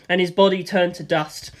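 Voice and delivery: plain reading voice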